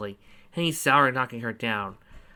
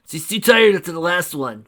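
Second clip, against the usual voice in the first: louder, rough barbarian voice; gruff character voice; 5 semitones higher, 8 dB louder than usual. Acted. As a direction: voice the big barbarian. Fafnir answers in character.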